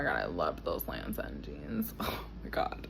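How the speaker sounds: breathy whisper of delight. Real, not acted. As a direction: whisper it fondly. Fond memory of jeans.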